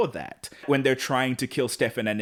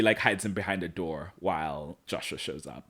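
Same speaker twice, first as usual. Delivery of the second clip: normal talking voice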